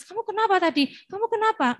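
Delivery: put-on high, pressing questions to a crying child; anxious parent. Parent pressing a sobbing child with high questions. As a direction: mimic a fretful parent asking too soon.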